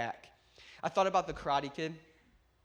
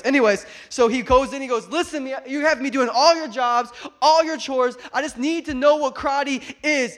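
excited retelling; 9 semitones higher, 12 dB louder than usual. Real, not acted. Preacher building excitement retelling.

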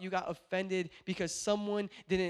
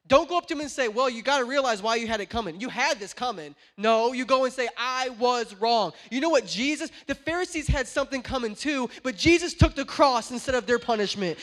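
loud, raised-pitch sermon building in force; passionate preaching; 6 semitones higher, 9 dB louder than usual. Real, not acted. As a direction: drive it home to the congregation, loud and high. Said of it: "I'm getting so passionate".

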